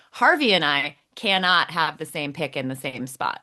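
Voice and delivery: ordinary delivery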